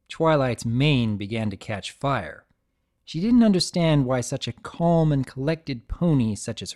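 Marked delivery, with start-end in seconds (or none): none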